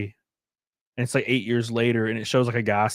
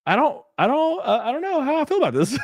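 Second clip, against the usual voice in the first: high, fast mock-hesitant voice; fretful high voice; worried supporter; 11 semitones higher than usual. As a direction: play the uneasy voter, pitched up. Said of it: "I'd be like".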